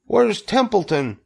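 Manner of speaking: high-pitched demanding question; anxious, high-voiced call; urgent demand. A narrator's reading. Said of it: "he demanded".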